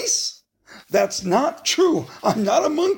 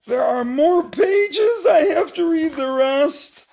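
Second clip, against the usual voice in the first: higher whining complaint; whiny dismay; 8 semitones higher than usual. Pretend. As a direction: whine at the thought of more pages. Reader dismayed at more pages.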